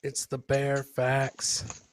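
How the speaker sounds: ordinary talk, nothing marked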